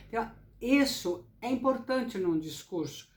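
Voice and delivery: ordinary talk, nothing marked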